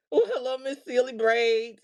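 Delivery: normal talking voice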